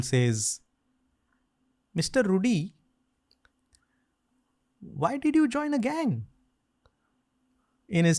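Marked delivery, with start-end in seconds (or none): 1.9-6.3 put-on high-pitched Marathi-accented Indian father. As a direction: ask it like a Marathi dad, rising high. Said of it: "like, Marathi accent".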